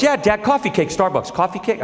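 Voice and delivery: put-on kid voice. Son pestering dad for coffee cake. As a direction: nag insistently like a little boy. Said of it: "he goes".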